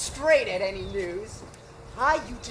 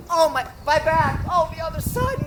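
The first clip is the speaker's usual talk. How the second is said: ordinary talk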